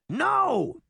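very high, urgent yell; shout. A narrator's reading. Boy fairy stopping Sabrina's chase, high urgent shout. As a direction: yell it out, pitch leaping up. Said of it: "she heard Puck's voice shout".

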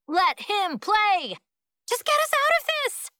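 high, loud crowd cries; clamouring shouts. A narrator's reading. Frozen kids join in urging Rosa, clamouring shouts. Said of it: "More voices join in".

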